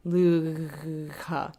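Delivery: very drawn-out "Lugha" with a rasping gh; gh in the throat. Shown as a demonstration. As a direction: stretch "Lugha", rolling the gh in the throat. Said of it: "here in your throat when you do it".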